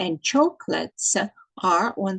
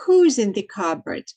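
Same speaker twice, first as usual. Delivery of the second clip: ordinary delivery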